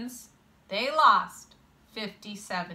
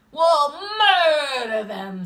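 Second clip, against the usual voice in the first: loud, drawn-out, higher boast; gleeful threat; 5 semitones higher, 8 dB louder, slower than usual. A narrator's reading. Kid boasting about crushing the other team, loud drawn-out threat. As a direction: stretch out the threat with relish. Kids threaten the opposing pair.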